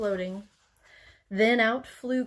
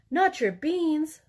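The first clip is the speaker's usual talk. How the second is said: higher, louder, slow outraged cry; slow dismayed outburst, pitched up; 6 semitones higher, 4 dB louder, slower than usual. A narrator's reading. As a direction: cry it out, scandalized. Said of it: "cried Skipito, outraged".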